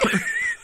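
short squeal of laughter; high-pitched laugh. Real, not acted. Guest hearing that listeners wonder about him, squealing laugh.

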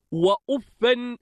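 وأفا said "wa-uffan", ending in an n; accusative tanwin. Shown as a demonstration. Teacher showing students وأفا as "wa-uffan", with tanwin. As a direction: end وأفا on "-fan". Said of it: "بالنصب والتنوين".

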